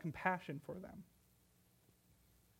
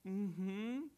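guilty mumble; 9 dB quieter than usual. Retold or imitated. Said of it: "And I go".

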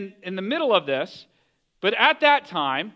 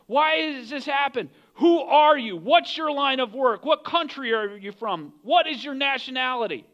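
raised, higher-pitched interrogation; yelling; 5 semitones higher than usual. Acted. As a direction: fire urgent questions as the alarmed crew, voice up. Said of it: "They're probably yelling at him".

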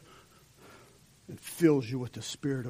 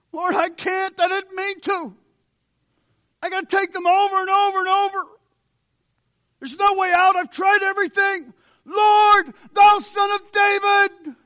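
much higher, louder pleading to the Lord; desperate cry; 10 dB louder than usual. Retold or imitated. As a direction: beg for rescue at full cry. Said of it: "if you call today".

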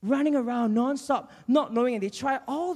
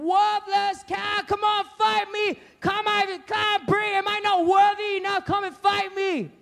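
tormenting taunt; 10 semitones higher than usual. Acted. Giant tormenting the army to come and fight, jeering. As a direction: goad them relentlessly at a high pitch. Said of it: "this huge man that kept tormenting them".

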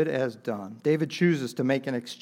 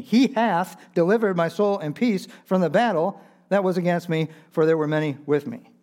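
raised, loud scripture declaration; emphatic proclamation; 5 semitones higher, 6 dB louder than usual. Retold or imitated. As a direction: proclaim the verse from the pulpit at full voice. Proclaiming the verse as faith's fuel.

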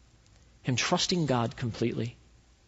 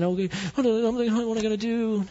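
mock anxious fretting, pitched up; whiny panic; 8 semitones higher than usual. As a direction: imitate someone flustered and fretting. Mocking a panicked clamoring person.

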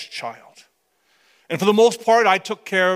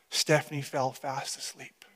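hushed whisper, much softer; 6 semitones lower, 11 dB quieter than usual. A narrator's reading. Narrating baby asleep in a soft whisper. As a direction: whisper so as not to wake her.

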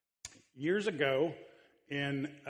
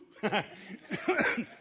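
laugh; 8 semitones higher than usual. Real, not acted. Laughs at own joke.

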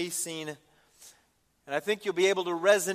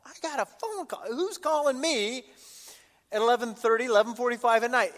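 incredulous; 6 semitones higher, 5 dB louder than usual. Retold or imitated. Preacher recalls a late-night call in the ER, incredulous. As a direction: squeal it in puzzled surprise.

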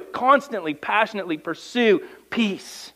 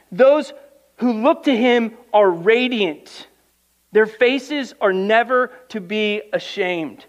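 higher and slower, each word weighed; preaching scripture; 4 semitones higher, 4 dB louder, slower than usual. Acted. Preacher reading a psalm to his congregation, higher and slower. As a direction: proclaim it slowly to the church.

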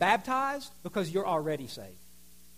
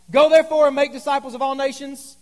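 louder, higher, fully engaged read; rally the crowd to join in; like we love it; 6 semitones higher, 11 dB louder than usual. Shown as a demonstration. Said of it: "like we love it and like we're checked in".

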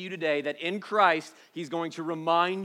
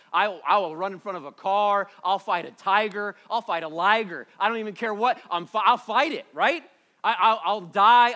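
raised, loud, impassioned vow; fierce protectiveness; 4 semitones higher, 8 dB louder than usual. Real, not acted. Father vowing to fight anything for his kids, fiercely. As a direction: build with force toward a readiness to die.

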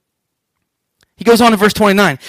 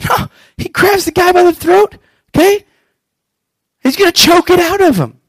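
excited; 8 semitones higher than usual. Real, not acted. Preacher rising over the choking.

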